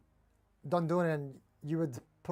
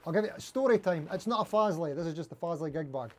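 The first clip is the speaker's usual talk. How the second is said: normal talking voice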